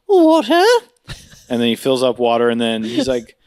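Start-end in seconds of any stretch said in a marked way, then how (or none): 0.1-0.6 high, loud, hammed-up question; waiter impression. Waiter returning with a pitcher, loud high put-on voice. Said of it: "and he goes".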